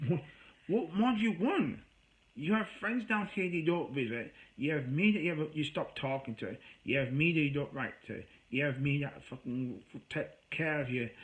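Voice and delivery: exasperated rant. Retold or imitated. To the friend who says no one loves her, an angry rant. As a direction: unload all the frustration on her.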